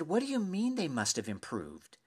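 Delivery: high-pitched, affronted questioning; incredulous. Retold or imitated. Fan questioning his neighbor's faint praise, incredulous. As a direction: challenge it in disbelief.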